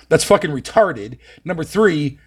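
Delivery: ordinary delivery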